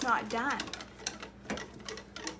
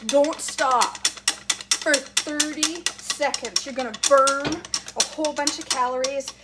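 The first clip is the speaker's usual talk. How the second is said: loud, higher coaching while working hard; effortful urging; 3 semitones higher, 6 dB louder, slower than usual. Real, not acted. Cook urging viewers through thirty seconds of whisking, effortful. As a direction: push the words out over hard whisking.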